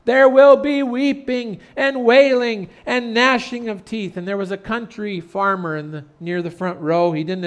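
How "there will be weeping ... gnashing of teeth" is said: slow, loud, high preacher delivery; emphatic repetition. Retold or imitated. Preacher repeating the warning a third time, emphatically. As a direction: hammer each phrase home at full voice. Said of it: "said it a third time for emphasis".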